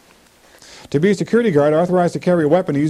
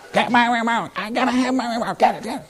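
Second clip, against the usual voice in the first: higher, mock frantic demand for "my remote"; mock frantic parent; 8 semitones higher than usual. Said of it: "You're yelling at the kids".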